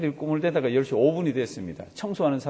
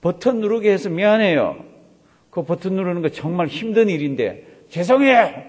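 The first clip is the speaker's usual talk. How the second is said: higher, louder sarcastic sorry; barbed mock apology; 3 semitones higher, 6 dB louder than usual. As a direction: act out an apology with a thorn in it, punching the sorry. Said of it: "속에 가시가 있는 거라". (가시 돋친 사과를 흉내 내며 비꼬듯)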